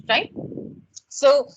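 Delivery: ordinary talk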